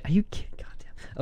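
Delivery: whispered dismay. Real, not acted. Trader sees the runtime and whispers his dismay. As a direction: hush it under your breath. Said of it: "whispered at the time".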